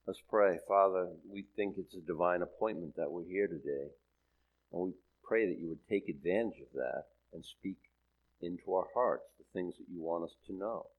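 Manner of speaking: soft, humble spoken prayer. Acted. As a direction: pray quietly to the Lord.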